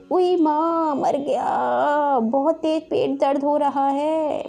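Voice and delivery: drawn-out wail of stomach ache; hammed-up bellyache moan; groaning in pain.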